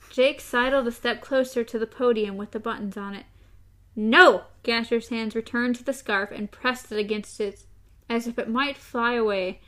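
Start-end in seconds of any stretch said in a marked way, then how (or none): 4.0-4.4 loud flat refusal; refusing. A narrator's reading. Gasher refusing TikTok, a loud no. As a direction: bark out the refusal.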